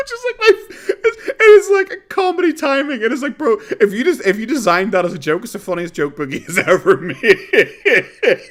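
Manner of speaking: high, loud laughter through the words; can barely speak for laughing; real helpless laughter; laughing hard. Real, not acted. Cracking up at the clip.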